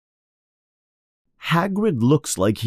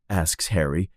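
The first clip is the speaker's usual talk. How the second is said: plain reading voice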